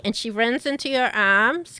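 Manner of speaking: normal talking voice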